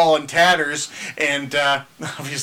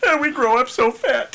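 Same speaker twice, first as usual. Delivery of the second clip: higher goofy dad voice; Richard's voice; 6 semitones higher than usual. Retold or imitated. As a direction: play the dopey proud father. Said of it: "When you do Richard's voice".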